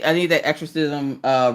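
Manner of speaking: ordinary talk, nothing marked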